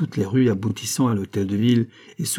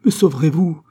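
high, loud anxious plea; trembling cry; 7 semitones higher, 6 dB louder than usual. A narrator's reading. Foulon asking to be saved, crying out fearfully. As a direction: beg urgently, pitched up. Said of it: "s'écria en tremblant Foulon".

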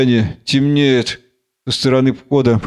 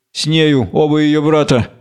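ordinary delivery

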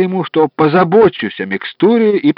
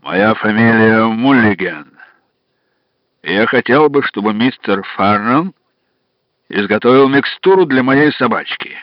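put-on Irish accent; 4 semitones lower than usual. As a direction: speak in a thick Irish accent. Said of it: "сказал с сильнейшим ирландским акцентом".

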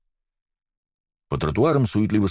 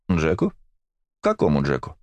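curious echo. A narrator's reading. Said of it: "заинтересованно переспросил Зорин".

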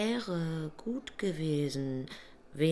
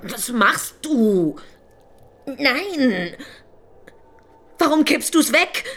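louder and higher, aghast; alarmed protest; 9 semitones higher, 10 dB louder, slower than usual. Acted. Angel protests as the prophet pours away the water, loud and alarmed.

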